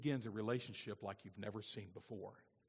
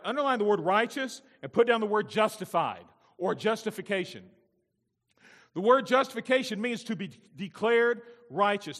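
loud, high pulpit proclamation; raised, ringing sermon delivery; forceful preaching; 7 semitones higher, 12 dB louder than usual. Real, not acted. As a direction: drive each point home at full preaching volume.

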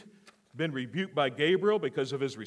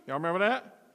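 usual speaking voice

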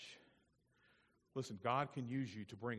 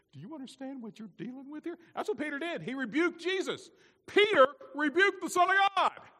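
very high, building to a shout; incredulous full-force preaching; 14 dB louder than usual. Real, not acted. Preacher stressing that Peter rebuked God's Son, high and shouted. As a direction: build to a loud, astonished climax.

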